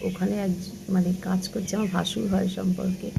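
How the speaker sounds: hushed voice, keeping it down; speaking softly. Real, not acted. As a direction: talk quietly so nobody hears. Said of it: "তার জন্য একটু আস্তে আস্তে কথাবার্তা বলছি".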